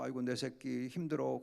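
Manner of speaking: put-on soft, quiet parent consoling a child; tender comfort. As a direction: hush and hold a tired kid. (지친 아이를 달래는 부모처럼 부드럽고 조용하게)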